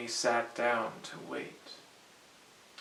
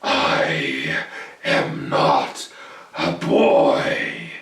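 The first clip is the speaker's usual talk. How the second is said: much lower, loud and very slow; menacing goblin king; 10 semitones lower, 11 dB louder, much slower than usual. Acted. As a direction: drag out each word to loom over him.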